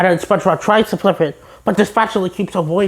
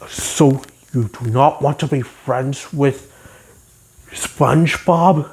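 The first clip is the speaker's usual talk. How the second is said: lower, slow, stern stag; Great Prince voice; 4 semitones lower, slower than usual. Retold or imitated. The Great Prince questioning the spatula, low and stern. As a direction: ask slowly and sternly, low. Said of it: "if the Great Prince ... he would say".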